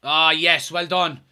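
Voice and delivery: ordinary talk, nothing marked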